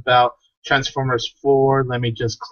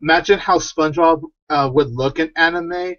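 usual speaking voice

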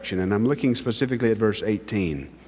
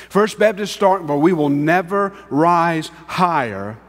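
raised, fervent pulpit exhortation; impassioned preaching; 5 semitones higher than usual. Acted. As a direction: push the challenge to the church. Preaching at full force.

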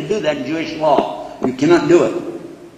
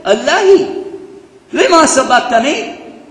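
high, louder cry to God; raised outcry; 11 semitones higher, 5 dB louder than usual. Acted. Preacher voicing the cry to my God, raised outcry. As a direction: call it out, pitched well up. Calling out Jesus' cry in Aramaic.

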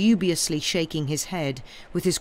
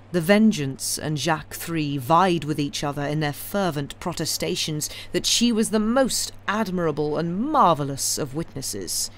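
plain reading voice

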